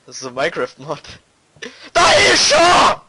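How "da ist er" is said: berserk rage. Real, not acted. Gamer raging at enemy.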